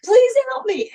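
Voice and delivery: a dying patient begging for help; pleading in pain. Retold or imitated. Dying patient pulled back in, crying out for help in pain. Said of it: "they go".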